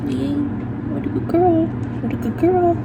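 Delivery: high sing-song dog praise. Real, not acted. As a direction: coo it to the puppy.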